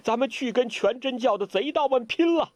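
high, grief-stricken shouting; a grieving disciple shouts for revenge; tearful shout. Acted. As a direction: rage at them through tears. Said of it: "大声叫道".

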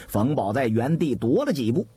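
usual speaking voice

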